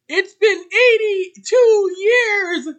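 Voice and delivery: high, slow, drawn-out crying wail; mock wail. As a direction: wail it like a tragedy. Mock lament over the long wait.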